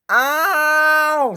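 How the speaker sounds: high, loud yelp; hurt engine's loud ow; cry of pain. Acted. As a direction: yelp as if hit. James hurt in crash.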